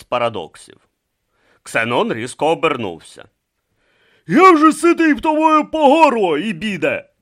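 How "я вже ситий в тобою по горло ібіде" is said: loud, high exasperated roar; furious high bellow. Acted. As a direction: roar at your rival, fed up. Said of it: "проривів він".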